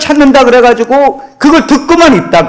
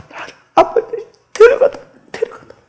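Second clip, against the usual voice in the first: high, strained, garbled pleading; 말 못하는 딸의 애쓰는 목소리; mute girl straining to speak; 13 semitones higher, 5 dB quieter, faster than usual. Acted. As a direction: force the words out like someone who cannot speak. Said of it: "이 딸이".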